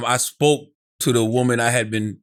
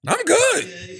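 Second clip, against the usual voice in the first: "I'm good" pitched way up, a squeaky, unconvincing denial; high mock denial. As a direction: insist you're fine in a strained high voice. Repeats the brush-off, sitting beside girl.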